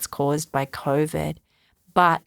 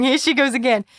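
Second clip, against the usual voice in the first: higher, mocking listener's sigh; mock eye-roll; 8 semitones higher, 4 dB louder, faster than usual. Podcaster mimicking listeners rolling their eyes, mocking tone. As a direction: tease herself as the bored listener would. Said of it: "rolling your eyes right now, going".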